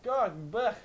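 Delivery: hammed-up high disgusted ugh; disgusted groan. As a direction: groan it out like she's gross. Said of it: "I was like".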